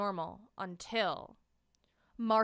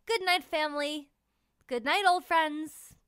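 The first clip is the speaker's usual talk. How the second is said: tender goodnights; 6 semitones higher than usual. A narrator's reading. Narrator saying goodnight to all, tender.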